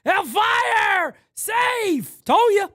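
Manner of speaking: loud, high triumphant yelling; excited shouting. Real, not acted. Gamer yelling at the screen in triumph, loud excited shouts. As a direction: crow over the play you called. Celebrating a game play.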